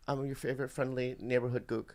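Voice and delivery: soft, measured, word by word; slowed down. Pretend. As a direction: take it slowly and quietly, as instructed. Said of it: "Do it slow".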